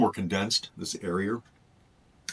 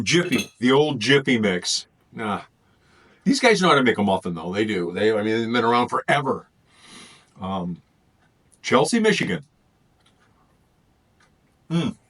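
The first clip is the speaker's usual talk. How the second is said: ordinary talk, nothing marked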